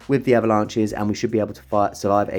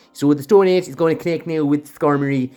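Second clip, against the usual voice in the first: hammed-up Irish commentary, pitched higher; ridiculous Irish accent; 4 semitones higher, 4 dB louder than usual. Gamer commentating on Stone Edge in a daft Irish accent. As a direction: slip into a silly, exaggerated Irish accent. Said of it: "doing that in a ridiculous Irish accent".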